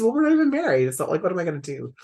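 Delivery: high, loud indignant reply, then fast; indignant comeback to the husband; indignant retort. Retold or imitated. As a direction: fire it back, incredulous. Her retold retort to husband.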